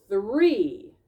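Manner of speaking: loud counting call. Acted. Tobin calling the final count. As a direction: sing out the last number. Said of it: "Tobin called out".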